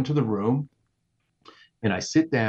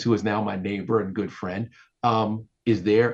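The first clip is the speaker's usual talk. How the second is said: ordinary delivery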